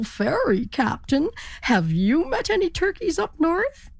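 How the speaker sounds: pleading high turkey question. A narrator's reading. Turkey asking captain.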